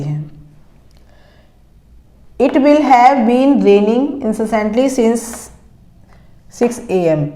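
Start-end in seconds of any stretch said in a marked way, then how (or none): 2.4-7.2 slow, word-by-word dictation of English sentences. Shown as a demonstration. Teacher dictating sentences to students, slow and careful. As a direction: read each word out slowly so students can write it. Reads sentence slowly again.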